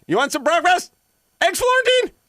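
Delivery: high, loud calling out. Retold or imitated. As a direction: call it out sweetly from the house. Wife yelling as he leaves.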